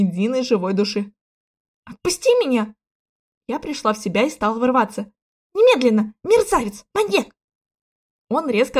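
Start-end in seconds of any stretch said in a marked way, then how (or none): 1.8-2.7 high-voiced protest; struggling, outraged cry; indignant demand. A narrator's reading. Heroine struggles against the prince. 5.5-7.3 high, indignant outburst; rising angry exclamations; outraged insults. A narrator's reading. Heroine angrily insults her captor.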